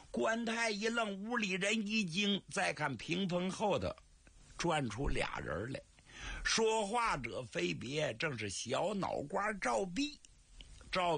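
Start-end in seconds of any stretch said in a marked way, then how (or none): none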